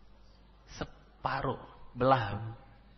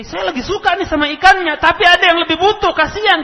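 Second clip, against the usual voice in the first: nada tinggi dan keras penuh semangat; nasihat lantang; 13 dB louder than usual. Retold or imitated. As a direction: tekankan dengan suara tinggi dan keras. Ibnu Umar menasihati istrinya. (Ibnu Umar menasihati istri dengan suara lantang)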